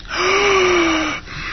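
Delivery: sharp high mock gasp. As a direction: gasp in fake shock. Mock shock on air.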